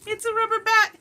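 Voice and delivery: very high, loud burst of excitement; delighted squeal. Real, not acted. Opening a friend's gift of a rubber bat, squealing with delight. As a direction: squeal with glee over a surprise gift.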